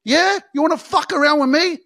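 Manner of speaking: high, loud taunting dare; loud defiant taunt; defiant challenge. Retold or imitated. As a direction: throw the challenge back at them. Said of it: "you're gonna go".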